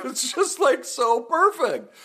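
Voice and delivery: real laugh breaking through the words. Real, not acted. Cracking up over the ghost-hair comment, laughing.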